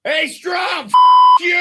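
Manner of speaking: high, loud heckle that cuts itself off; an angry fan yelling at the mound; angry September Red Sox fan. Pretend. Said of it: "this is like September Red Sox fan".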